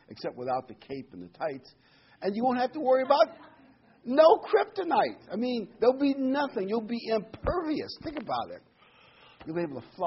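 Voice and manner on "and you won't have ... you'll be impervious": high, loud, rushing then slowing; excited pulpit punchline. Real, not acted. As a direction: build up to the punchline. Preacher enthused about being impervious.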